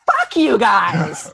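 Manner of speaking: loud, drawn-out mock yell; hammed-up holler, stretched out; outburst. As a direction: imitate a sudden furious outburst. Friend bursts out at the circle.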